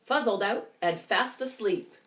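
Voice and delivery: ordinary delivery, nothing marked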